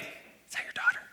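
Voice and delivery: hushed, shocked whisper. Retold or imitated. Speaker whispering to his new hire at the door. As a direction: whisper it so nobody else hears.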